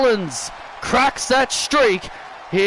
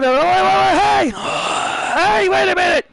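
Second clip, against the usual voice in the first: alarmed shout; 8 semitones higher than usual. Real, not acted. Commentator seeing his colleague grabbed, alarmed shout. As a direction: cry out as the attack starts. Gunther attacks a broadcast colleague.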